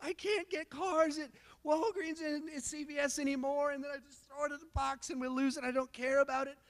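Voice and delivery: put-on high, teary little boy complaining to mom; crying son voice. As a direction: play the upset kid telling on dad. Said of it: "is just like".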